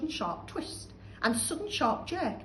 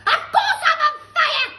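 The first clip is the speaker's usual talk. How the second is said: panicked shout; 14 dB louder than usual. Acted. Grandma burning inside, screeching shout. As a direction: shriek for help. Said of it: "she yelled".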